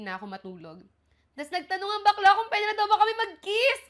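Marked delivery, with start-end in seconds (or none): 1.4-3.8 louder, much higher giddy telling; kilig-filled retelling; gushing excitement. Real, not acted. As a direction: rush it out, high and giddy. Host gushing over the kiss.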